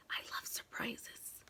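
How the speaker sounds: soft breathy whisper, barely voiced. A narrator's reading. Reading to kids, the boy whispers his wish. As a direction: hush it like a secret. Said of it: "and he whispered".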